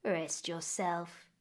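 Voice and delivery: calm her down, very slowly and softly; soothing old woman. Acted. Old woman soothing the weeping May, soft and very slow.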